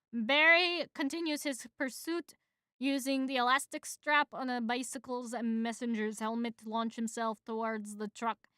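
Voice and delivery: ordinary delivery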